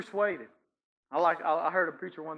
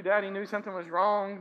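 normal talking voice

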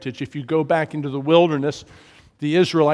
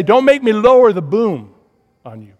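higher, louder fatherly threat; stern dad warning; 6 semitones higher, 11 dB louder than usual. Retold or imitated. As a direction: warn like a dad at bedtime. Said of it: "Dad would say".

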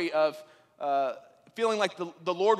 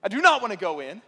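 1 exasperated driver; 5 semitones higher, 8 dB louder than usual. Retold or imitated. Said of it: "and I'm like".

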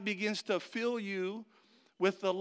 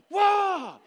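much higher, louder startled yelp; panicked whoa; 12 semitones higher, 10 dB louder than usual. Retold or imitated. Player panicking with seconds left, yelping loud. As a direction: cry out as the ball lands on you. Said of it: "I was like".

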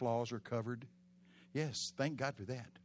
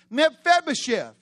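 calling out; 14 semitones higher, 16 dB louder than usual. Acted. King calling his name.

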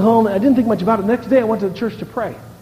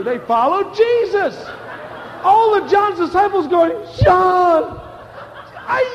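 preaching at full force; 10 semitones higher, slower than usual. Acted. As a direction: drive the story up to the crowd. Preacher retelling John's story.

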